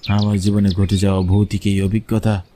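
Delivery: ordinary delivery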